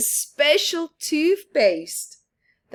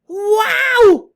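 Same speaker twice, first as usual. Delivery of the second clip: high, loud shout of amazement; excited shout; 13 dB louder than usual. Acted. Boy shouting at passing comet, thrilled. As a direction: yell it out in wonder. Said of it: "he shouts".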